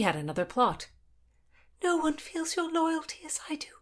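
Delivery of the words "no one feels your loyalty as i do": high, hushed whisper; girl whispers her loyalty to the guard. Acted. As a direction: breathe it out, barely voiced. Constance whispers her plea.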